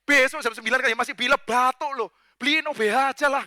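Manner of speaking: irritated inner rant, played for laughs; exasperated inner voice. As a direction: complain as you secretly would to a late-night caller. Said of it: "Dalam hati".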